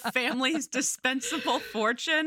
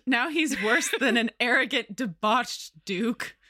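normal talking voice

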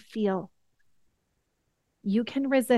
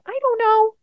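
put-on higher and louder, a frustrated kid; a hint of a upset little boy; child's reply; 11 semitones higher, 6 dB louder than usual. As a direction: voice her son's helpless answer, pitched up. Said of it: "And he said".

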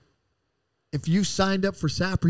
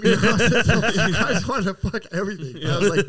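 laughing through the words, higher; 6 semitones higher, 3 dB louder than usual. Real, not acted. Telling the group about being high, laughing. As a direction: giggle it out as you remember. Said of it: "laughs while telling".

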